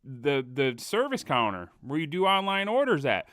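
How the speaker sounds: high-pitched defensive explaining; exasperated protest. Retold or imitated. Customer explaining the receipt to an accusing worker, exasperated. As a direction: plead your case, exasperated. Retelling his protest to the screaming lady.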